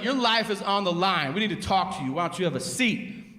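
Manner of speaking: high, stern interrogating cop; stern detective. Retold or imitated. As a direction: press the suspect like a cop. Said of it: "they're literally like".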